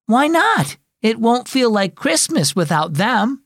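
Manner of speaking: high, dismayed protest; upset pleading. A narrator's reading. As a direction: protest like a hurt kid. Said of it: "cried Sybil".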